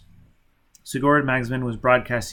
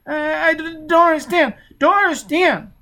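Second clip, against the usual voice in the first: put-on high, halting child's voice; high frightened child faltering; scared stammering child; 7 dB louder than usual. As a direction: stumble over the words in fear.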